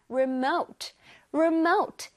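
remote with the second syllable MOTE stressed. Shown as a demonstration. Teacher drills children on remote, stressing MOTE. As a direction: land firmly on the MOTE of remote. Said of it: "M O T E 부분에 강세가 들어가요".

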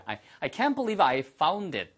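ordinary talk

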